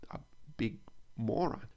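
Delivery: ordinary delivery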